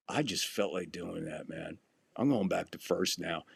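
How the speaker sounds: low, soft deadpan reply; private exchange. Retold or imitated. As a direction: say it quietly so only one person hears. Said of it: "So nobody heard all that".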